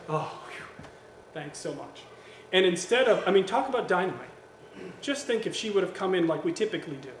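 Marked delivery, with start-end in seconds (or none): 0.1-2.0 soft, low, exhaled relief; acted relief. The wife hears the innocent explanation, softly relieved. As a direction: let out a hushed sigh of thanks.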